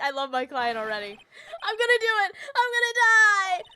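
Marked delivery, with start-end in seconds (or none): 1.6-3.6 hammed-up wailing, much higher and louder; goofy despair. Larry wails to his lawyer, melodramatic shouting. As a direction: cry out like it's the end. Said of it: "I was playing this goofy".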